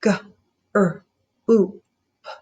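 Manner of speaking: G, r, u, p each spaced; four separate sounds of group. Shown as a demonstration. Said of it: "Say it sound by sound and count".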